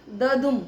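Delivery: ददुम with an easy द and a strong दुम; stress on second syllable. Shown as a demonstration. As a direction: put power on the दुम of ददुम. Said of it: "दुम में हमें एकदम power लगानी". (ददुम में दुम पर ज़ोर)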